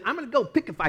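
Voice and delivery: attempted Scottish accent, pitched high; half-hearted Scottish warrior impression; William Wallace. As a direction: have a rough go at a Scottish accent. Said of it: "I can't do my Scottish accent".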